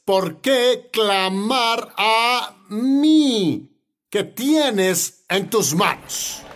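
God challenging Moses. Acted. God answering Moses at the Red Sea, slow challenge. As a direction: stretch each word out as a divine rebuke.

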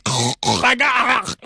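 high amused laugh breaking out mid-story; burst of laughter. Real, not acted. Host telling listeners about brunch, laughing aloud. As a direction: laugh at the memory of gagging.

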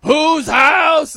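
loud, high mock PA yell; stadium announcer shout. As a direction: imitate the hype man calling to the crowd. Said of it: "not as bad as the Rams, like".

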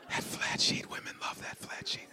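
whispering. Real, not acted. Confiding aside to audience.

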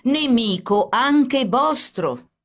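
very slow, loud, high-pitched insistence; drawn-out, heated emphasis; angry. A narrator's reading. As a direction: hammer each word out in anger. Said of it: "spiegò il carabiniere adirandosi".

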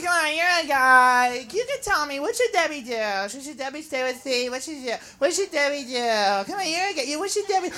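soft, whiny mock pleading; pestering girlfriend's friend. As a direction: pester a guy for advice in a small voice. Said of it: "they're always like".

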